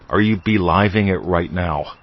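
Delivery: "beliving" said as "be-LIVE-ing", stretched long; funny pronunciation. Shown as a demonstration. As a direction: blend believe and live into "beliving". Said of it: "I'm just saying it funny".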